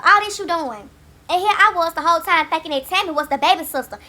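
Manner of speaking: usual speaking voice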